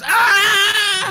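high loud agonized cry; dying shriek from the sawn enemy; pained scream. Acted. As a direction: scream as the blade bites. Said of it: "And the guy goes".

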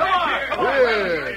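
high shouted demand; impatient shout. Acted. Townsman pressing the masked man, high impatient shout. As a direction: push him for an answer, shouting.

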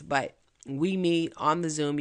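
ordinary talk

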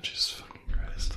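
hushed, voiceless muttered curse; whispered dismay. Real, not acted. Co-host reacting to the boom boom question, dismayed whisper. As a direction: swear under your breath.

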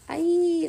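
loud, high drawn-out wail; creature's cry. A narrator's reading. Hydra crying out as Petra grabs its tail. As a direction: wail out high like a startled beast. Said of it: "the Hydra cried out".

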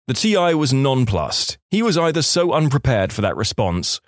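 plain reading voice

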